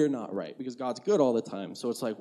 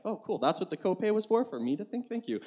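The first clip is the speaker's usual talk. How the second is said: high-pitched sarcastic thanks, softening at the end; mock gratitude; 7 semitones higher, 3 dB quieter than usual. Patient answering an unhelpful ER doctor, mock grateful. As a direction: play politely unimpressed, pitched up.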